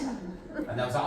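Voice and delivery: usual speaking voice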